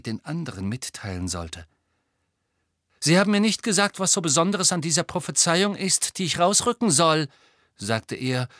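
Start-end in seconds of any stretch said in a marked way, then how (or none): 3.0-7.3 higher, insistent complaint; Harry stalling. A narrator's reading. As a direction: challenge them to explain, playing for time. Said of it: "und spielte auf Zeit".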